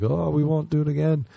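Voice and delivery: put-on high innocent kid voice. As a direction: sound sweet and innocent. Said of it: "we were like".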